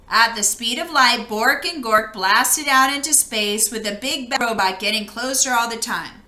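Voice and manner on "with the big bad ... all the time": usual speaking voice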